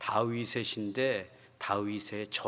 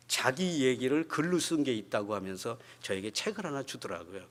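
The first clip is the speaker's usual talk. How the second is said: ordinary delivery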